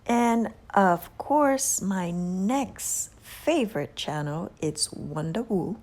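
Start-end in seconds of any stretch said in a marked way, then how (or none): none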